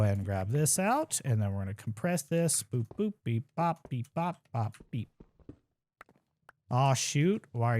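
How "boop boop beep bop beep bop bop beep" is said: playful beeping noises. Pretend. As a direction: chirp little machine sounds to yourself while crafting. Playful crafting sound effects.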